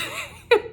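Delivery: sudden high laughing burst; burst of laughter. Real, not acted. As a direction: break into a real laugh. Laughing at the Manchester accent.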